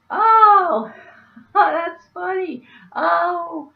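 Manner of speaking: squealing high laughter through the words; high, loud, breaking into laughter-cries; cracking up laughing. Retold or imitated. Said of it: "And we both cracked up".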